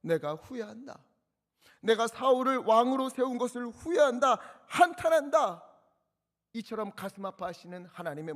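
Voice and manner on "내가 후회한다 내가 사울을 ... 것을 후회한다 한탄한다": God's lament. Acted. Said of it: "그 모습을 보면서".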